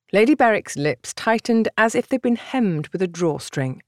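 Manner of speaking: plain reading voice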